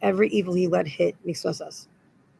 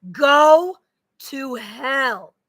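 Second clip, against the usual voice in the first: sharp loud curse; curse shout; 5 semitones higher, 6 dB louder, much slower than usual. Real, not acted. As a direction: Go burst out higher and louder. Cursing an absent man.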